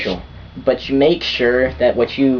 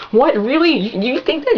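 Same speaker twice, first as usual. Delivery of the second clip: very high, mock incredulous squeal; shocked girlfriend impression. Girlfriend reacting to boyfriend's careless yep, mock shocked. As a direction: imitate a girl's appalled disbelief, voice shooting up.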